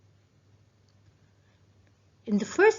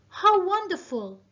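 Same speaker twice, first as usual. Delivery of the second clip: higher, delighted exclamation; exclamatory reading; 7 semitones higher, 4 dB louder than usual. Shown as a demonstration. Teacher reading an example exclamation aloud, higher and delighted. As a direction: sound thrilled by it. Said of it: "what does the speaker want to emphasize?".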